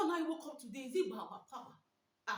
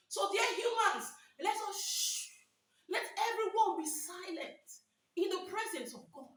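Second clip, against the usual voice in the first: raised, fervent preaching voice; 5 semitones higher, 3 dB louder than usual. Acted. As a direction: proclaim it to the congregation. Preaching at full force.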